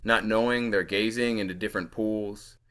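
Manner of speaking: measured, lilting poem recital; poetry reading. Acted. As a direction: read the poem aloud with deliberate phrasing. Reads own poem aloud.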